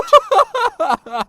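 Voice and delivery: mocking laugh. Rival mocks.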